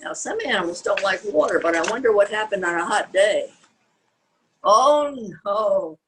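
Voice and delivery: ordinary delivery, nothing marked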